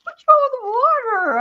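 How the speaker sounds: a puzzled high ask; husband's question. Retold or imitated. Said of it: "And he goes".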